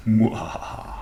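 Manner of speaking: breathy mock evil cackle; whispered panto villain laugh. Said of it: "Trying to be as evil as possible".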